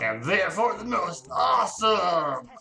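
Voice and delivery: loud, over-the-top proud boast; hammed-up shout. Reading a cringe fanfic to viewers, hammed-up shout. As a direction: shout it like a smug new hero.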